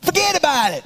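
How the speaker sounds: high, hammed-up Chicago accent; bad Chicago accent. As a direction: put on a horrible mock Chicago accent. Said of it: "how they do that in Chicago".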